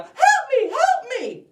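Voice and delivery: very high, loud screams for help; panicked screaming. Acted. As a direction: scream like a terrified little girl. Girl still screaming.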